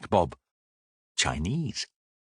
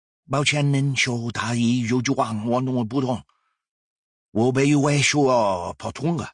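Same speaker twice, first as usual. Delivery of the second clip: a quick, higher stream of Mandarin; rapid Mandarin; 6 semitones higher than usual. A narrator's reading. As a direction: rattle it off to baffle him. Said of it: "Her reply is rapid".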